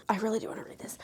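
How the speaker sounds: soft eager whisper. Real, not acted. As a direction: whisper your wish. Whispered aside about a book.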